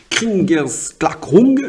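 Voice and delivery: Kriegsklackung spoken very slowly, in hesitant French-accented German; careful German word. Shown as a demonstration. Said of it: "excusez-moi donc de la prononciation".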